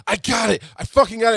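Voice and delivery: excited shout. Retold or imitated. Husband calling his wife with the news, excited shout. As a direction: yell the news down the phone. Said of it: "call my wife, like".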